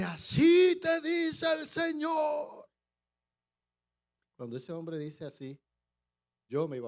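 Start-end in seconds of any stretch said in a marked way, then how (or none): 0.0-2.7 high, loud, slow proclaiming shout; booming prophetic decree, drawn out; voice of prophetic authority. Retold or imitated. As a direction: thunder it out like a prophet declaring God's word. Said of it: "se para con una autoridad que yo nunca había escuchado".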